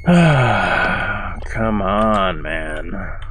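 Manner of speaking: drawn-out plea, sinking away; weary exasperation. Real, not acted. Gamer stuck on the puzzle, weary exasperation. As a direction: start on a sigh and let it fall. Sighing in frustration at game.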